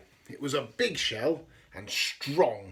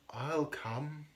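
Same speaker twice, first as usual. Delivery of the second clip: plain reading voice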